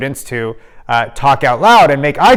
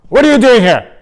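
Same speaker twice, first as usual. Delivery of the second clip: woken principal; 9 semitones higher, 7 dB louder than usual. Retold or imitated. Sleepy principal at the door.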